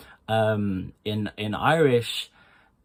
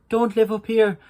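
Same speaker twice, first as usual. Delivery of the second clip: much higher and louder; high put-on voice; 12 semitones higher, 8 dB louder than usual. Shown as a demonstration. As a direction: squeak it up high to show what not to do. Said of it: "That's not realistic".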